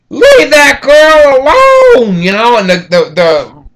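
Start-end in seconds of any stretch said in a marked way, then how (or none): 0.1-2.2 high, loud, slow shout from a window; neighbor yelling. Retold or imitated. Neighbor shouting down from a window, high and loud. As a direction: yell it out the window at the attacker. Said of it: "a bunch of people opened the windows".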